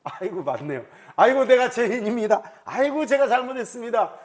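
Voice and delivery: hammed-up, loud apology; 설득당한 사과. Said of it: "다윗이 설득당해가지고". (과장되게 큰 소리로 잘못을 비는 말투)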